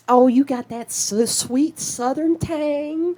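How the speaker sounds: high, drawn-out gushing voice; mock gushing admirer. Friend mocks people who gush over her accent, high gushing voice. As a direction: coo it like a fawning fan, sweet and slow.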